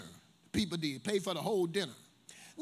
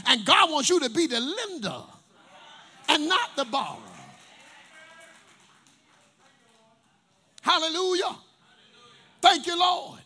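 loud preacher's cry; preaching at full force; 4 semitones higher, 7 dB louder than usual. Acted. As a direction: let it ring out over the church. Preaching climax.

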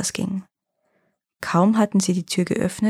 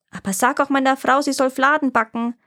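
higher, lifted storybook call; calling after; 6 semitones higher than usual. A narrator's reading. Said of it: "rief er dem Hasen hinterher".

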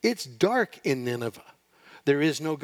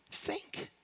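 breathy whispered "Think."; 12 dB quieter than usual. Retold or imitated. God whispering one word to Jonah. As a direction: whisper it as a last plea. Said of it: "you can almost hear God say".

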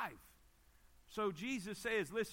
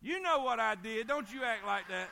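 louder, scolding mock outburst; retold marital quarrel; 5 dB louder than usual. Husband and wife quarrel retold from the pulpit, scolding. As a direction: imitate an aggravated spouse snapping back.

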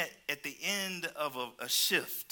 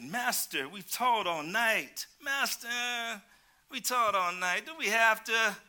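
put-on higher, louder childish whine; whining child; 7 semitones higher, 5 dB louder, slower than usual. Preacher voicing Peter to the church as a whining child. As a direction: whine like a kid dodging chores. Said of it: "What that sound like? Some children".

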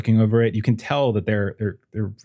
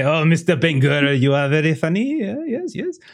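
put-on higher, louder French-accented voice, climbing; amused French parent; 7 semitones higher, 3 dB louder than usual. French parent telling guest he is funny, amused.

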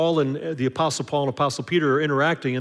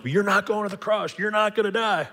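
higher, protesting Peter, lightly voiced; 6 semitones higher than usual. Peter objecting to Jesus, lightly voiced higher. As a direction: insist he won't go to the cross. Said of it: "Peter's going like".

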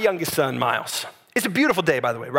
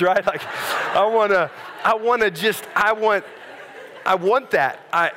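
usual speaking voice

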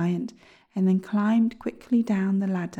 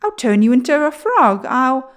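loud, higher, furious dwarf threat; angry threat; 4 semitones higher, 10 dB louder than usual. A narrator's reading. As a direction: threaten the boy at full voice. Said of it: "he cried angrily".

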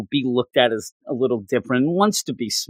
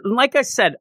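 usual speaking voice

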